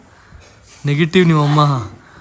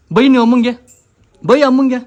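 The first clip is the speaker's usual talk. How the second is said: playful egging-on; 8 semitones higher, 6 dB louder than usual. Real, not acted. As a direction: egg the little one on, raised voice. Egging child to scold mother. (ಜೋರಾಗಿ, ಎತ್ತರದ ದನಿಯಲ್ಲಿ ಹುರಿದುಂಬಿಸುತ್ತಾ)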